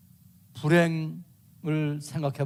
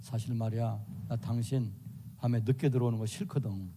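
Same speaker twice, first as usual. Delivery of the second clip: lower, softer calm voice; a wife calmly raising a complaint, lower and softer; 7 semitones lower, 7 dB quieter than usual. Acted. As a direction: ask your husband gently, without heat. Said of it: "이렇게 말로".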